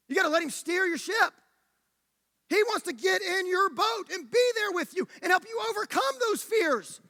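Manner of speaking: high, urgent sermon appeal; impassioned preaching. Real, not acted. Preacher urging the listeners.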